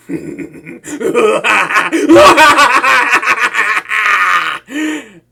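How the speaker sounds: long loud scheming laugh; triumphant laugh. Acted. Villain about to phone the hero, long triumphant laugh. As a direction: cackle at the chance to call her.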